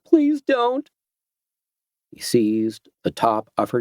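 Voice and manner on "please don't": even higher, louder, near-breaking plea; desperate begging. Acted. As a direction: beg with rising panic. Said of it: "she begged him".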